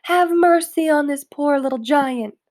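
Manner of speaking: high, loud begging giant; a giant pleads with Jack in a nightmare; pleading yell. A narrator's reading. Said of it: "screamed the flesh lump eater".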